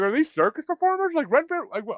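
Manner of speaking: high incredulous questions; bewildered. Retold or imitated. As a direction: act baffled by what you see. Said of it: "I'm like, I'm like".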